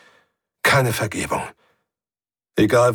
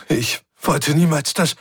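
tearful; 6 semitones higher than usual. A narrator's reading. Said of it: "Die Kontrolle über ihre Stimme entglitt".

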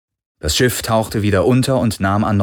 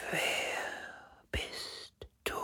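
very slow, barely audible wind whisper; faintest whisper; 19 dB quieter, much slower than usual. A narrator's reading. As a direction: whisper it like a dying breath of wind. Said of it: "so leise wie der Schatten des Hauchs eines Flüsterns". (hauchzartes, langsames Flüstern)

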